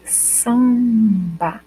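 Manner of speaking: samba bem devagar, m nasalizando o a; slow demo. Shown as a demonstration. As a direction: alongue samba mostrando a nasal. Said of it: "quando eu digo samba, olha". (samba dito devagar para ouvir a nasal)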